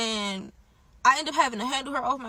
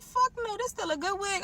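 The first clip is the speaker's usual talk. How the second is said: high outraged; 8 semitones higher, 3 dB quieter than usual. Retold or imitated. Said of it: "I'm like".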